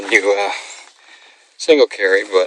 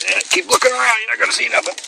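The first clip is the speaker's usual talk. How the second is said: teasing the dog; 13 semitones higher, faster than usual. Real, not acted. Teasing dog that sees nothing.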